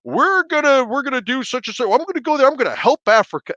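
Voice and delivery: high, far mock voice of a smug volunteer; mocking naive volunteer. Mocking volunteers who go to Africa, high put-on voice. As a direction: lampoon the do-gooder in a high put-on voice. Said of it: "you know, the".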